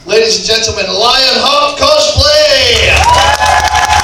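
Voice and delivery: drawn-out booming announcement; announcer shout. Acted. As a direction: call the name out like a ring announcer. Host announces the contestant.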